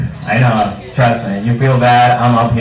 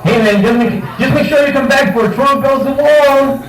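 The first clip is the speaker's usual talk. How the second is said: mock friend; 11 semitones higher than usual. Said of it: "he goes".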